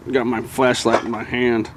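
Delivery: ordinary talk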